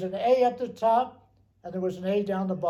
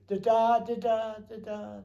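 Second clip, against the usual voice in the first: fading radio signal; 3 semitones higher than usual. Shown as a demonstration. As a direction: let the beeps die away like a signal fading out. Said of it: "If you picked up an A sound and you were going away from the airport".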